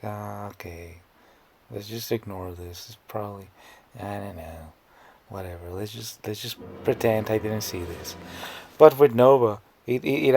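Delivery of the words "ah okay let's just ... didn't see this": soft, indifferent dismissive murmur; mock shrug. Imagining shrugging off someone else's video, quiet and indifferent. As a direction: brush it off under his breath. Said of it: "I would be probably like".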